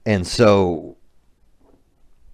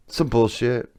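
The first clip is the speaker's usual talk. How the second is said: usual speaking voice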